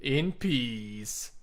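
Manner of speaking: echoing the narrator's eerie sign-off; deadpan spooky narrator voice; weird narrator imitation. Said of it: "he does a lot of these with this weird narration".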